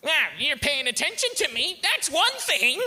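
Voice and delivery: hammed-up kid working up to a wail; mocking kid voice. Comedian mimics the kid getting attention, high mocking kid voice. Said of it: "this is where the kid always goes".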